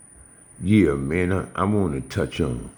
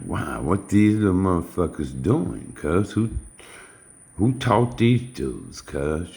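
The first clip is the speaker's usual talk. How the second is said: higher-pitched incredulous questioning; embarrassed disbelief; 5 semitones higher than usual. Retold or imitated. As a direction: recount it like you can't believe these dudes. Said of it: "I was embarrassed".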